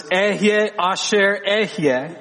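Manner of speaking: "Ehyeh asher ehyeh" said slowly in Hebrew; careful, unhurried Hebrew "Ehyeh asher ehyeh"; Hebrew phrase. Shown as a demonstration. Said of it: "Vayomer Elohim el Moshe".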